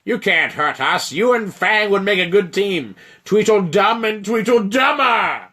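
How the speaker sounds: loud, high taunting giant voice; mocking giant. Frost giant taunting the elf and the dragon. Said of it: "the giant laughs again".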